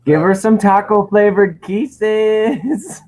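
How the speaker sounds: high, slow, hammed-up kissy wish; mock-smoochy singsong, high and drawn out; playful silly voice. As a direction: stretch it out in a goofy put-on voice. Jokey kiss wish to fan.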